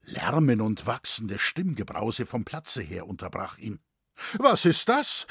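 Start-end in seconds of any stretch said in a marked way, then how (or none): 4.4-5.3 high, loud alarmed question; raised voice, startled; startled cry. A narrator's reading. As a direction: call out in alarm to the others. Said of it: "riefen die Ratsherren".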